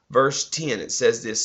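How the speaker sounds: ordinary delivery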